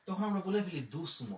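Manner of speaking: usual speaking voice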